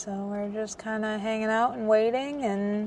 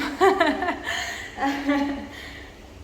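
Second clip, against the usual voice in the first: embarrassed laugh; 5 semitones higher than usual. Real, not acted. Embarrassed in ladies' room.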